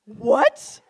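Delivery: a high, stunned what; shocked. Retold or imitated. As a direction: caught completely off guard. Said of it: "I was shocked".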